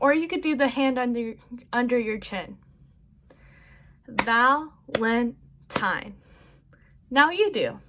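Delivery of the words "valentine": very slow, one beat per syllable; clapped syllables. Shown as a demonstration. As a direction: clap out each syllable as you say it. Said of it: "I'm gonna do the clapping technique".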